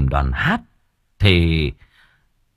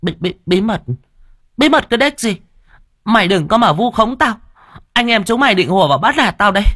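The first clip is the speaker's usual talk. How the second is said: feigned indignation; 13 semitones higher, faster than usual. A narrator's reading. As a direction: stammer into shrieky high denial. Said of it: "nhưng vẫn cứ giả mồm".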